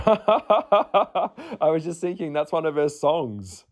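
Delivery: laughing through it, slow and delighted. Real, not acted. To viewers, realizing the line is her song, laughing. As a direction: giggle out the realization, unhurried.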